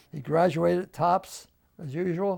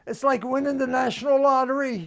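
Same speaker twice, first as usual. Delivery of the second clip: high, loud, elated exclamation; thrilled, raised-voice outburst; excited; 9 semitones higher, 6 dB louder than usual. Retold or imitated. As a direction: proclaim it like you just hit the jackpot. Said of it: "I was so excited".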